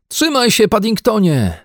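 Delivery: high urgent call. A narrator's reading. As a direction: call out to the bear. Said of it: "wtórowała mu Judyta". (głośne wołanie)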